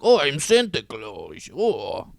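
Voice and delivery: slow, hammed-up Russian accent. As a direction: imitate Alec Baldwin's Russian Santa. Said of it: "he's doing a Russian accent".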